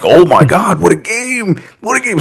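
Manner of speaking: high, gushing mock excitement; imitated excited fan. Co-host imitates the Bayern fan greeting him, high excited gushing. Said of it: "He was all excited".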